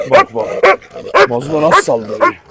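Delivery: usual speaking voice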